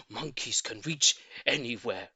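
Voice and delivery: plain reading voice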